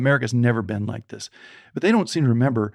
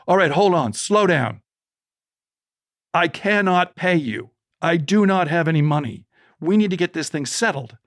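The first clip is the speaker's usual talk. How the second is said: anxious insisting; 5 semitones higher than usual. Retold or imitated. Panicked dad insists he can't pay.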